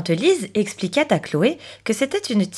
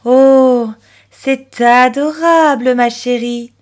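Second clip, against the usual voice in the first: loud, slow, drawn-out motherly delight; gushing compliment; 4 semitones higher, 7 dB louder, slower than usual. A narrator's reading. Mother praising Chloé for helping, gushing. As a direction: coo it adoringly, stretching the vowels. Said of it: "complimenta maman".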